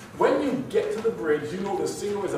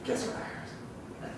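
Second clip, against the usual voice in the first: hushed, slow gossip whisper; conspiratorial whisper, drawn out; juicy-secret whisper; 14 dB quieter, slower than usual. Acted. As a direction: lean in and whisper it like hot gossip. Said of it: "do you shout it out at the top of your lungs?".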